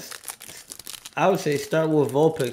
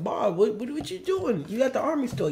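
a high, surprised voice for the friend's question; quoting a friend; 9 semitones higher, 4 dB quieter than usual. Retold or imitated. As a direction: play the buddy, shocked and curious. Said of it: "he said, he said".

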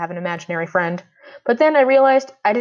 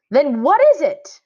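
higher, louder, slow shout; 8 semitones higher, 6 dB louder, slower than usual. A narrator's reading. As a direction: yell it out in frustration. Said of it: "I shouted".

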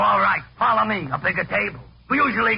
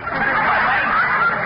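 incredulous laugh; 4 dB louder than usual. Acted. Waiter laughs at washroom request.

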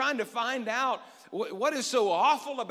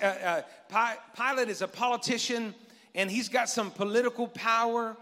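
ordinary talk

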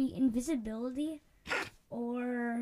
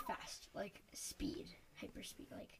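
whispering; 5 semitones lower, 18 dB quieter, much slower than usual. Real, not acted.

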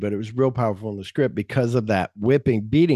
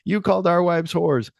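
rapid, high confused protest; fast and high; 7 semitones higher, faster than usual. Retold or imitated. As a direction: rush it, higher. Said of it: "he's like".

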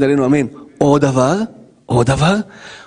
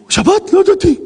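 shocked innocence. Acted. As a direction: gasp as if you truly had no idea. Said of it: "זה נקרא חטא".

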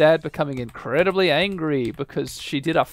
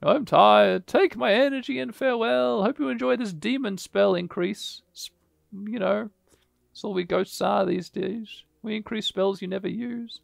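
high, tired ghost voice; weary ghost; 8 semitones higher than usual. Acted. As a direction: sigh it out like a worn ghost. Reads ghost's lines.

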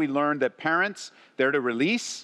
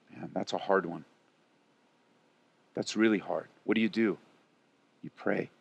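much softer and lower, letting the pain sit; gentle sympathy; 9 semitones lower, 6 dB quieter than usual. Real, not acted. Pastor consoling a grandparent cut off from grandkids, soft and low. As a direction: drop right down, quiet and tender.

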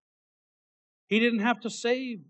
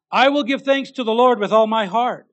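proclaimed; 5 semitones higher, 8 dB louder than usual. Acted. Preacher proclaims the verse.

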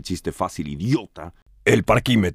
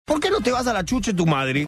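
mock insult; 11 semitones higher than usual. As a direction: fire back a hammed-up insult. Host insults the caller. (insulto exagerado y agudo)

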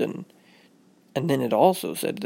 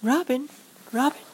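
high, tentative call; lightly voiced mom checking on her child; mother calling; 13 semitones higher than usual. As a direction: call gently through a closed door. Mother calls through the closed door.

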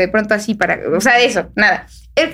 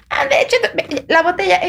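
mock insisting; 7 semitones higher than usual. Mimics second attempt to insist.

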